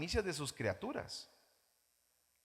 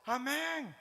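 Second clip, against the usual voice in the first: mock amen; 8 semitones higher than usual. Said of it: "Y todos".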